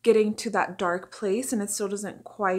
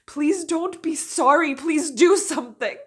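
desperate plea; 8 semitones higher, 8 dB louder than usual. Acted. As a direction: burst out pleading, raised and urgent. Captive's plea breaks out, acted.

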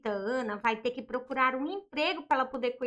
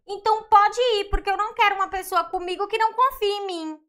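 loud, fast, fed-up comeback; louder and higher, throw the words at him; wife daring her husband to walk out, loud and angry; angry retort; 4 semitones higher, 6 dB louder, faster than usual. Acted. Wife tells husband to leave.

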